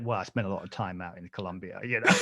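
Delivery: low, hushed shady-dealer voice; drug dealer impression. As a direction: imitate a dealer making a discreet offer. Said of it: "you sound like a drug dealer".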